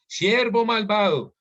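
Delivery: king rebuking the wicked servant, slow and loud; angry master. A narrator's reading. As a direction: call him out slowly as the angry king. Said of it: "su señor enojado".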